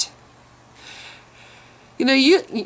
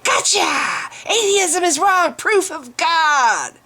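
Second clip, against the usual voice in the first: higher, taunting gotcha voice, drawn out at the end; gloating mockery; 8 semitones higher, much slower than usual. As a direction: crow like a smug opponent who thinks he's won. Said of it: "and you're just like".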